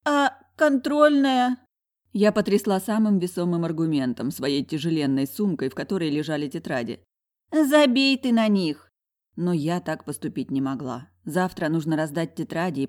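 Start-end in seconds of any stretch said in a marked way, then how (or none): 0.1-1.6 high, loud, very drawn-out objection; tipsy protest. Acted. Пьяная героиня возражает подругам. 7.5-8.9 loud, high, drawn-out brush-off; exasperated dismissal. Acted. Подруга отмахивается от возражения.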